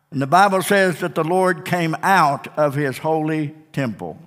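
normal talking voice

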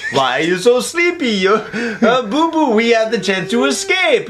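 swooping cartoon bear impression; Yogi Bear. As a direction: lead bear chiding his little pal. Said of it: "then Yogi Bear's like".

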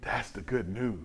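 quiet, low landing after the shouting; hushed refrain. Real, not acted. Preacher settles the good news on the church, hushed. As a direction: drop it low and soft.

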